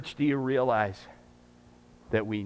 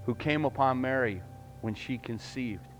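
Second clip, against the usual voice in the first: prayer; 2 semitones lower, 6 dB quieter than usual. Acted. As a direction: pray quietly and earnestly. Prays over music.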